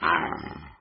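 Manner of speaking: low growled "arr"; dog growl. Acted. Husky dogs meeting the newcomer, growling. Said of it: "The dogs growled".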